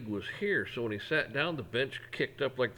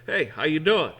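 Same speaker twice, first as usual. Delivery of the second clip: loud, higher friendly hello; hearty greeting; 5 semitones higher, 8 dB louder than usual. Shown as a demonstration. Showing how to greet people by name, loud and friendly. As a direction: greet them eye to eye with energy. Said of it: "It's like".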